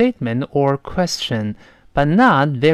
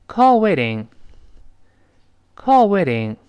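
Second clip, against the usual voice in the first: quick flapped waiting; fast with flapped t; 4 semitones higher than usual. Shown as a demonstration. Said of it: "但你读快了之后".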